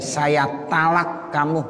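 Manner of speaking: "talak" with plain ta, said slowly; slow phrase, light t and open a in "talak"; ta-initial pronunciation. Shown as a demonstration. Husband who cannot say to, saying "talak" with ta. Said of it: "Bukan tolak, talak".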